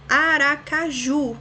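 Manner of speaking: Aracaju slow, loud and high, syllable by syllable to a strong ju; stress on last syllable. Shown as a demonstration. Teacher giving an example, Aracaju stressed on final ju. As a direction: spell out Aracaju by syllables, weight on ju. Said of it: "ju é a última sílaba mais forte".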